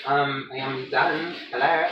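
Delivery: high, light bacon voice; bacon's little voice. Pretend. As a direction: give the bacon a squeaky little voice. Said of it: "I think it's gonna be like".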